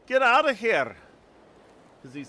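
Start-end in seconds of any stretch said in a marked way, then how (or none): 0.1-1.0 loud, high-pitched Scottish outburst; Scottish accent. Acted. Scottish lighthouse lookout chasing off visitors, angry Scottish shout. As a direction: yell it as an irate Scottish lookout. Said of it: "get the Scottish accent going".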